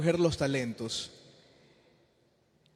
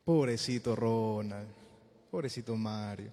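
mock self-pity; 7 semitones lower, 3 dB quieter than usual. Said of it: "A decir".

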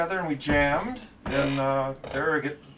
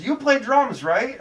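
put-on high, louder eager voice of an old buddy; eager question; 9 semitones higher, 4 dB louder, slower than usual. Friend spotting drummer in produce aisle, eager high call. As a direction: call it out like you just spotted him. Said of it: "he goes".

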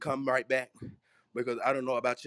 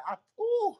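ecstatic squeal. Real, not acted. Overcome by the spirit.

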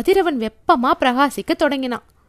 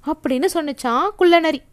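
ordinary delivery, nothing marked

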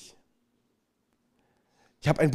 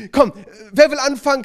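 much higher and louder coaxing call; urging; 11 semitones higher, 12 dB louder than usual. Retold or imitated. Leader pressing the silent group again, loud and eager. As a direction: push them to finally start.

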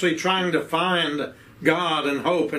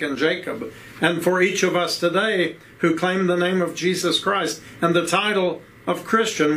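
ordinary talk, nothing marked

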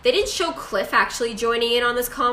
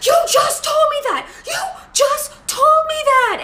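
furious shout, much higher and louder; mock outraged shout; 5 dB louder than usual. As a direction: yell it like losing your mind. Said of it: "Tommy literally loses his mind".

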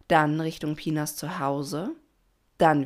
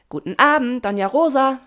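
high, ringing shouted greeting; loud call; 8 semitones higher, 10 dB louder than usual. A narrator's reading. Pina greets Doña Rosa to get noticed, calling loudly. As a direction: call it out loud across the river. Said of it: "Sie rief wieder laut".

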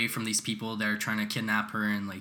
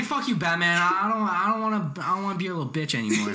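imitating fed-up Robin; 5 semitones higher than usual. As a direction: play a sidekick quitting in a huff. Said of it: "He's like".

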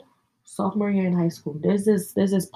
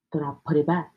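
ordinary talk, nothing marked

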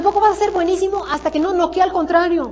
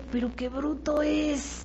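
slow, hushed, drawn-out disbelief; inner-voice mutter; 10 dB quieter, slower than usual. Retold or imitated. Coach thinking privately while a recruiter rambles, hushed disbelief. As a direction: mutter to yourself, very soft and slow. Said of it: "Pero entonces me decía yo".

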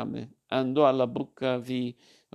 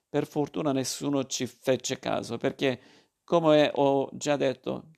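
ordinary delivery

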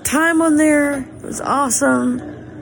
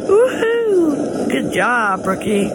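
cheering; 2 semitones higher, much slower than usual. Real, not acted. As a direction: whoop for the skier. Cheering skiers down slope.

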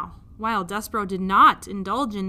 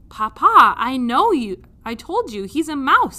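put-on loud, higher, exasperated child; insistent princess; 4 semitones higher, 10 dB louder than usual. Princess exasperated with her father, loud insistence. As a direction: drive the point home. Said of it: "said the P".

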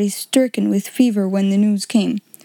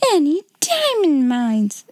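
higher, slower, dismayed outcry; startled exclamation; 5 semitones higher, slower than usual. Acted. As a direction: blurt it out as the dream fades. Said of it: "ejaculated Miss Minchin".

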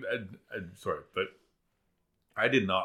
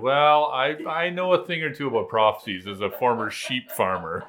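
mock pompous; 7 semitones higher, 12 dB louder than usual. Mock self-important farmer quote.

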